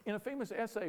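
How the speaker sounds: ordinary delivery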